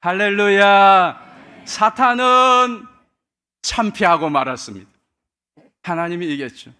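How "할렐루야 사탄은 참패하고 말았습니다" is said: slow, booming amen and hallelujah; loud proclamation. Real, not acted. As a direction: call it out to the whole church. Preacher proclaiming victory to congregation. (힘차게 외치는 선포)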